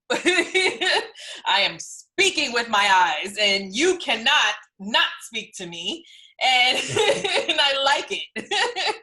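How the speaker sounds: talking through giggles; amused, laughing delivery. Real, not acted. Laughing while admiring own eyes.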